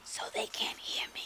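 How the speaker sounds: hushed whisper, drawing them in. Shown as a demonstration. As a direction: whisper so they have to lean close. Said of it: "really low".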